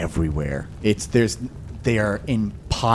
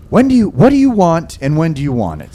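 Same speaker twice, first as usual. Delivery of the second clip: shopkeeper voice; 8 semitones higher, 6 dB louder, faster than usual. Acted. GM voices Getty.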